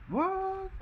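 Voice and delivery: surprised. Real, not acted. Shocked by game event.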